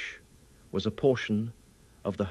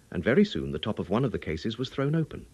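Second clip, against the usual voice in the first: plain reading voice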